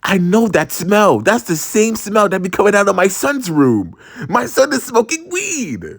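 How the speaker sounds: high, rising mock-mom outburst; alarmed mom. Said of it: "And she was like".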